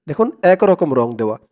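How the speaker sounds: normal talking voice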